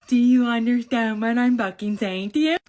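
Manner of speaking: loud furious demand; angry yell. Retold or imitated. Sister yelling at her brother over the bottle, furious. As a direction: yell it at him, fed up.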